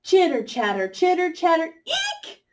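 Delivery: chattering monkey, squeaking high on eek; monkey chitter ending in a high squeak; silly monkey chatter. Shown as a demonstration. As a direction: play a silly little monkey. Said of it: "They're silly".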